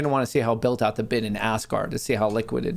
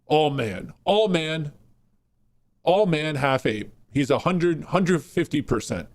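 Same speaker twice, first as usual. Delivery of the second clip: macho voice; 3 semitones higher, slower than usual. Pretend. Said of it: "really deep".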